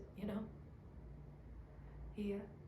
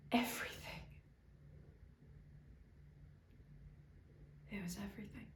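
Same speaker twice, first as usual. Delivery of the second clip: hushed whisper; 8 dB quieter, faster than usual. Real, not acted. Rant collapses to hush.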